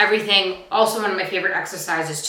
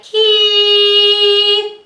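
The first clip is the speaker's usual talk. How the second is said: loud, high sung key; loud vibrating key; 11 semitones higher, 7 dB louder than usual. Shown as a demonstration. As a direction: belt it out with vibration. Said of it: "a nice loud, loud sound. It's gonna go like".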